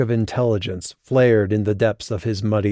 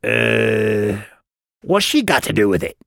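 puzzled goblin. A narrator's reading. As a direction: ask it like a confused goblin guard. Puzzled goblin guard questions Vicus.

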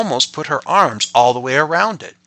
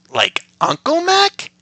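put-on child voice; 4 dB louder, slower than usual. Father voicing child.

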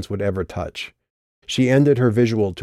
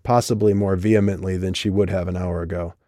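ordinary delivery

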